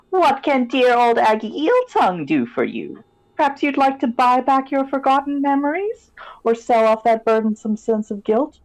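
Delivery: high crone voice, sly and selling; sly old hag offering a bargain; witch voice. Acted.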